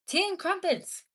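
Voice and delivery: high mock English accent; posh British voice. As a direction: say it like a prim Brit.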